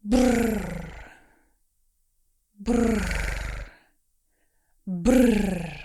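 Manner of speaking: Brrr with a long rolled r like an engine; rolled r engine sound. Shown as a demonstration. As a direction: trill Brrr with the tongue like a motor. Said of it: "Now we try a playful sound, like an engine".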